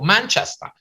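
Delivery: Manchester in a put-on English accent, rising high; echoing English accent. Repeating an English guest's town in an English accent. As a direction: parrot the town name back like a local Englishman. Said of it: "If they say Manchester, I go".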